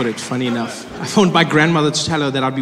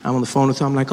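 usual speaking voice

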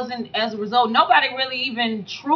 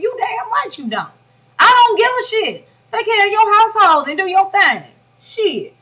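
emphatic agreement; 9 semitones higher, 4 dB louder than usual. Real, not acted. Agreeing loudly with Ray.

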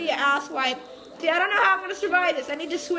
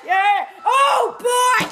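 higher, louder cheer; triumphant whoop; 7 semitones higher, 7 dB louder, much slower than usual. Real, not acted. Gamer cheers after winning mushrooms. Celebrates a win.

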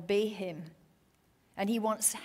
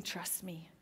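soft trust me; 5 semitones lower, 13 dB quieter than usual. Acted. God's voice, tender reassurance.

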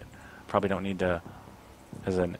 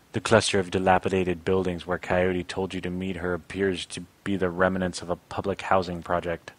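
ordinary delivery

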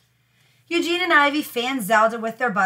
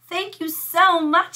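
sweet thanks; 7 semitones higher, slower than usual. Acted. Ivy thanks for lunch.

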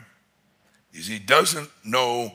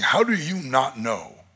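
pleading father; 9 semitones higher, 6 dB louder than usual. Acted. Father pleading with his older son, high and anguished. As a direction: cry it out as a father who can't believe it. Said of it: "I can only imagine that father saying".

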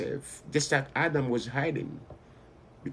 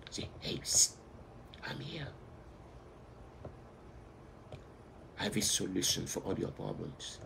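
hushed secret whisper; small whispering voice; 3 semitones lower, 11 dB quieter than usual. Pretend. Preacher voicing the small voice again, whispering softly. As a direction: whisper it close, like a secret offer. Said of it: "believe on the small voices".

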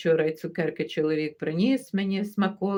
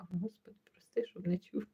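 whisper; 12 dB quieter than usual. Real, not acted. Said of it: "unvoiced whisper".

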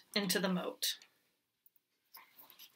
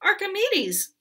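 queen calls out high and loud; calling out; 10 semitones higher, 5 dB louder than usual. Acted. Queen calls across to the goat, high and loud. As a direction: call across the moat. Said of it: "called the queen".

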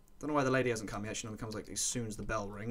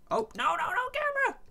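panicked wail; 6 dB louder than usual. Real, not acted. Panicking over the camera, a very high wail. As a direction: wail as the item slips away.

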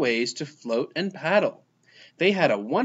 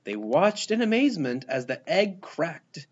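ordinary delivery

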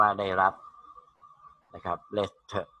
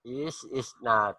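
slow model sentence; 5 dB quieter, much slower than usual. Shown as a demonstration. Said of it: "teacher models sentence slowly".

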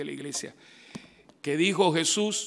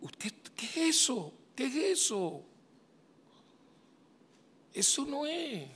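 high, drawn-out mock disbelief; slow, high-pitched plea of disbelief; acted dismay; 11 semitones higher, slower than usual. Preacher to people who go to mass to sleep, acted dismay.

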